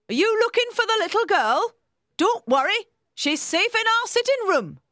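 high, loud housekeeper character voice; helpful housekeeper, reassuring. A narrator's reading. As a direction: pipe up loudly as the helpful housekeeper.

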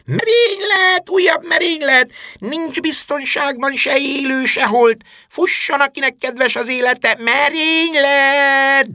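full-throated bellowing alarm, very high and drawn out; torkaszakadtából bömbölés. A narrator's reading. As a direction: scream the alarm at the top of your lungs. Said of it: "torka szakadtából bömbölni kezdett". (torkaszakadtából, magas hangon bömbölve)

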